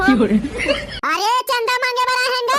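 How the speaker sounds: high, slow, laughing mangled ask; botched line. Pretend. Boy collecting donations fumbles his line, high and laughing. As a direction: blurt it out wrong with a giggle. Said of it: "हमन उल्टा पुल्टा बोल परे".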